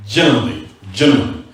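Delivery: "Generally" set apart as its own low statement; emphatic repetition. Real, not acted. Teacher qualifying a ruling for students, deliberate emphasis on "Generally". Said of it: "putting emphasis on the word generally".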